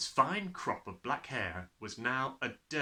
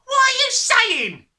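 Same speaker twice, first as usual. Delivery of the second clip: slow, very high, loud cry; 15 dB louder, slower than usual. A narrator's reading. Husband alarmed by his wife, crying out. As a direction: cry out, stretching every word. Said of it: "the husband cried".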